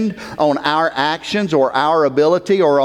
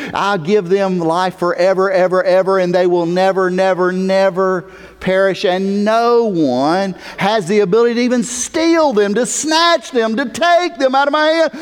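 high, insistent preaching building in pitch; emphatic; 7 semitones higher, 3 dB louder than usual. Retold or imitated. Preacher quoting Jesus' promise, emphatic. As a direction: pile up the words, climbing as you go. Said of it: "He is emphatic because he knows".

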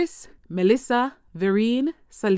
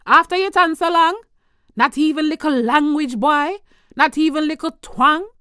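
lamenting Jamaican mother reciting in patois, higher and louder; Jamaican patois poem recital; 5 semitones higher, 5 dB louder than usual. Acted. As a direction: recite it as a scolding, disappointed Jamaican mother, rising to near shouts. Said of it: "Here's a little bit".

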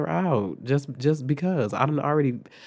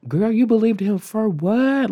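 high, loud, drawn-out challenge; indignant disbelief; 9 semitones higher, 6 dB louder than usual. Retold or imitated. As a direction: stretch it out, pitched high in outrage. Indignant at mother believing teacher.

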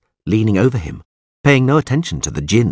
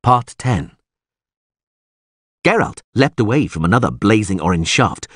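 plain reading voice

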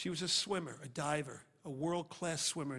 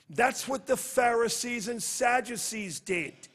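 preaching at full force; 6 semitones higher, 8 dB louder than usual. Real, not acted. Preacher raises voice.

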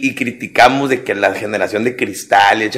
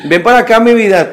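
high, louder sugary coddling voice; mock doting parent; 10 semitones higher, 5 dB louder than usual. Overprotective parent calling child out of sun, high cooing. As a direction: overdo the fussy parent.

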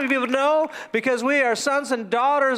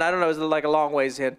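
ordinary talk

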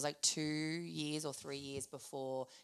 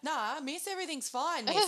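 higher, singsong fake innocence; high mock innocent voice; 9 semitones higher, 5 dB louder than usual. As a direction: play it sweet and falsely innocent. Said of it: "And I'm like".

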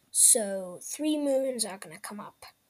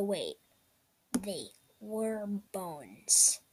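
ordinary delivery, nothing marked

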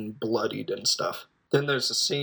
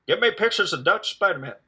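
lightly voiced boss, pitched higher and pushy; mock bossy demand; 7 semitones higher than usual. Boss demanding photos from the reporter, mock bossy demand. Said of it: "even if my boss is like".

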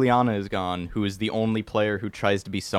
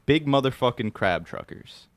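ordinary talk

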